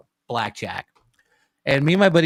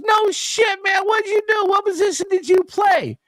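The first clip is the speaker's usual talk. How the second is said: much higher, shouting mock street guy; excited stranger; 4 dB louder than usual. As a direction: crank it up high and loud like an amazed stranger. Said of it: "Guy goes".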